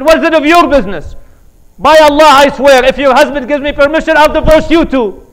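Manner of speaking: loud, high furious threat; angry outburst. Retold or imitated. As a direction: roar at the neighbor to mind her business. Husband shouts at nosy neighbor.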